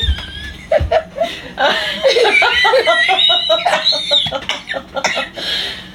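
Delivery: long high squealing laugh; very high, drawn-out cackle; helpless laughter. Real, not acted. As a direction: lose it completely, shrieking with laughter. Helpless laughter over the mistake.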